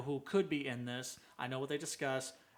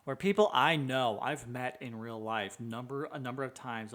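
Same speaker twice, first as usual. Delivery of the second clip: ordinary talk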